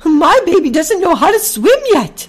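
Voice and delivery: high, loud cry for help; panicked mother goose shrieking for rescue; squawking goose. A narrator's reading. Mother goose begs help for drowning gosling, frantic squawk. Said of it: "squawked Ginger".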